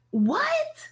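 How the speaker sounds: high, drawn-out disbelieving What; shocked what. Real, not acted. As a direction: squeal it in disbelief. Shocked reaction to the chat.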